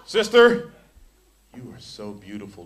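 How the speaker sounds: a preacher acting out a creepy compliment; mock sensual. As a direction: lay it on like a sleazy flirt. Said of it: "All sensual".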